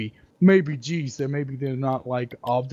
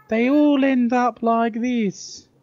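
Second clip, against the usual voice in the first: slow, high, loud fake-English accent; mock English accent; 10 semitones higher, 5 dB louder, slower than usual. Showing how Americans imitate English people, exaggerated English accent. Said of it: "tries to talk like English people".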